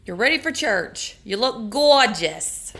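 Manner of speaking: slow, loud, fond compliments; warm praise. Real, not acted. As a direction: tell grandma she looks lovely. Praising grandma before church.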